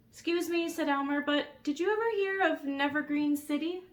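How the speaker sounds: put-on high, polite little boy. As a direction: ask very politely. Polite Elmer voiced.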